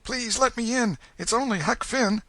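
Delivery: put-on frightened boy's plea, high and pressed; scared low tone. A narrator's reading. Huck at dawn asking the Welshman to open, scared. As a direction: ask to be let in, scared. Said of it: "Huck's scared voice answered in a low tone".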